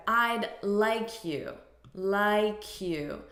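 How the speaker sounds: slow "like you" linked as "lie-kyou"; linked sounds. Shown as a demonstration. Teacher showing how sounds blend, "like you" as "lie-kyou". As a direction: carry the K of "like" onto "you", slowly. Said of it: "Notice the linking of these sounds".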